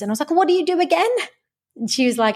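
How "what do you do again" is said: high-pitched naive question; mock-innocent asking, pitched well up; clueless caller. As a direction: play the clueless caller, voice lifted. Retold clueless caller asking receptionist.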